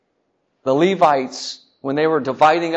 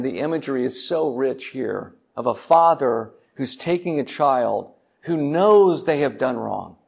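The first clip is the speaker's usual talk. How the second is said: ordinary delivery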